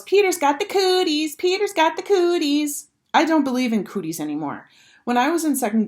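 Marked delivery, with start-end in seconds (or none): 0.0-2.9 high, singsong schoolgirl taunt; taunting yell. A narrator's reading. Sheila taunting Peter after touching him, high teasing yell. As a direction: tease him like a know-it-all girl. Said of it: "she yells".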